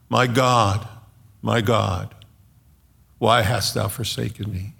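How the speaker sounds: normal talking voice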